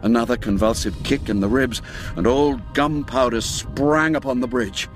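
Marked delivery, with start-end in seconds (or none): none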